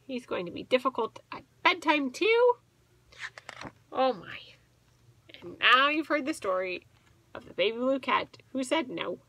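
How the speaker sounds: ordinary delivery, nothing marked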